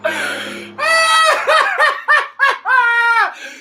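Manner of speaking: high, loud shrieking laugh; loud laughing fit. Real, not acted. Streamer loses it at the comment, loud laughing fit. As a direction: howl with laughter.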